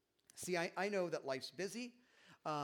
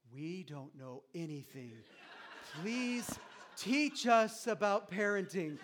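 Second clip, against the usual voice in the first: slow, hushed amazement with a laugh; awed dinner guests; 2 semitones higher, 7 dB louder, slower than usual. Acted. Guests begging the parents for advice, slow and awed. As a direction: marvel at the parents, slow and reverent.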